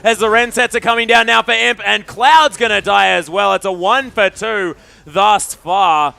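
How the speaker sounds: loud, high-pitched esports commentary; excited play-by-play. Real, not acted. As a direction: shout the kills as they happen. Live play-by-play at a kill.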